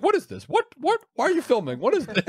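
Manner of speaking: high, baffled repeated questions; confused employee. Retold or imitated. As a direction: act like a startled store worker. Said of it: "He's like".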